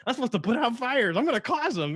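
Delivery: Hasenfuss. Retold or imitated. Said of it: "Hasenfuss is like".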